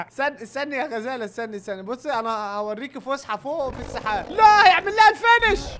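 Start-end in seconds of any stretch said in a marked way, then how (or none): 4.3-5.8 high, loud cry of protest; alarmed shout. Real, not acted. Gamer protesting as Hulk finishes the gazelle, loud high cry. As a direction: yell out to stop it.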